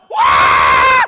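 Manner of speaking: big loud imitated yell as the coaster drops; mock drop scream. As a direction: let out a hammed-up falling scream.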